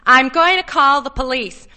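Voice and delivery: high, forceful voice; alarmed threat. A narrator's reading. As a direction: call it out as a desperate threat. Connie threatens Arnold.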